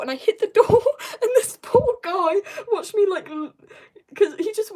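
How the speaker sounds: high, loud, breaking into giggles; can barely get it out for laughing; laughing while talking. Real, not acted. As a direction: crack up telling your own mishap. Telling own funny mishap, laughing.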